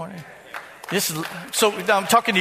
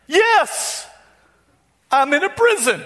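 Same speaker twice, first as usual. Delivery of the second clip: higher-pitched imitation of the church lady; imitating the lady; 9 semitones higher, 4 dB louder, slower than usual. Pastor retelling a counseling visit, voicing the lady higher. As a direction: play her voice higher, plaintive and helpless.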